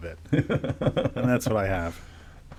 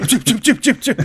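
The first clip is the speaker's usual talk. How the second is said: high, loud squeaky chip chip sneezes with a laugh; cat sneezes; 12 semitones higher, 6 dB louder than usual. Retold or imitated. Describing the cat to a friend, high laughing sneeze sounds. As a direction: mimic a cat sneezing in quick bursts.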